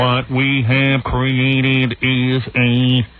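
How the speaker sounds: put-on very slow, droning hillbilly accent; mock a hillbilly speaker, dragging every word. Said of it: "that hillbilly accent".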